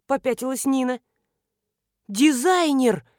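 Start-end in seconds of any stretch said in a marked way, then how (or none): 2.1-3.1 loud, high cry with falling end; startled exclamation. A narrator's reading. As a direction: blurt it out loudly in alarm. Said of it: "подпрыгнула наша невеста".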